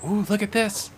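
put-on high, wide-eyed little kid; child voice. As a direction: coo over the flowers like a child. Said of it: "is like".

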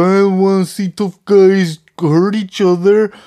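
a hint of a prissy complainer, mocked; mocking whiny fan. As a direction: mimic a squeamish fan you think is silly.

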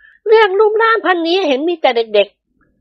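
very high, slow feminine voice; smiling, teasing woman. A narrator's reading. Praphai mocks the accident, smiling high tease. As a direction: tease him through a smile, high and drawn out. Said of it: "ประไพพูดยิ้มยิ้ม".

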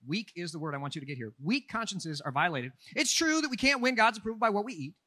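usual speaking voice

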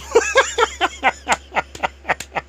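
high, loud burst of laughter; laughing. Real, not acted. Storyteller after teasing the blind man, high laughing fit. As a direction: crack up in a long run of high laughs. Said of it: "we were a little laughing".

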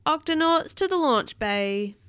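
storybook emergency call; captain's summons. A narrator's reading. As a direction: summon the crew like an announcer. Captain summons crew on alert.

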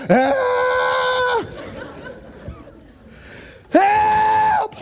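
high-pitched mock-panic scream; screaming for help. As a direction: scream it out as if on a runaway ride. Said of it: "I'm still screaming".

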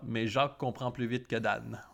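ordinary talk, nothing marked

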